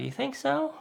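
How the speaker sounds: high, unsure question lifting at the end; doubtful question. Retold or imitated. Young driver asking the older off-roader for reassurance, doubtful.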